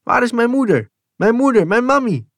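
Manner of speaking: put-on high, loud desperate child's call; child crying out. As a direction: wail it up high like a frightened boy. Kind roept om zijn moeder. (hoog wanhopig roepend kind)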